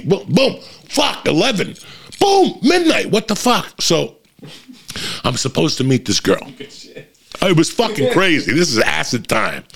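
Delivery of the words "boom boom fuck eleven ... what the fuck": high-pitched bursts of alarm; hammed-up shock at the jumps; startled disbelief. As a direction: blurt each one out, pitched way up. Acting out time jumping on acid.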